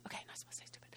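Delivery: quick hushed self-correction; whispered aside. Real, not acted. Preacher correcting own word choice to congregation, whispered.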